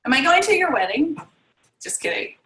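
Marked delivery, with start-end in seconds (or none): 1.8-2.3 soft, voiceless joking whisper; hushed jokey retraction; whispered aside. Real, not acted. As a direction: whisper it as a playful take-back. Joking aside after wedding question.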